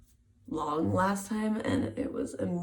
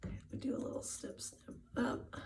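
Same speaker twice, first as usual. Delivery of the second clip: whispering; 4 semitones higher, 13 dB quieter than usual. Shown as a demonstration. Repeats the snip step whispered.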